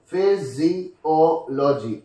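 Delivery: "Physiology" slow, syllable by syllable; broken into syllables. Shown as a demonstration. Teacher showing stress on the O, "Physiology" slowly broken up. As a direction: separate each syllable of "Physiology", O on its own. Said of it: "on the O we generally get it".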